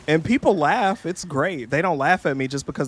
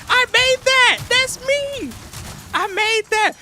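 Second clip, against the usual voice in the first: much higher, louder proud yelling; excited shout; 5 dB louder, slower than usual. Retold or imitated. Seeing her thumbnails on his TikTok, shouting with pride. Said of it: "I was just like".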